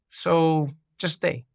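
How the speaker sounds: slow, simple "so just stay"; gentle urging. Retold or imitated. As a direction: lay it out slowly, no fuss. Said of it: "matter-of-fact way".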